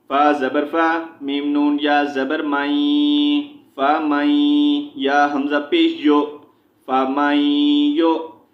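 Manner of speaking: measured chant, syllables held long; Quran spelling drill. Shown as a demonstration. Teacher spelling Quranic words for learners, chanted drill. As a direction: spell out each letter, then draw out the joined syllable.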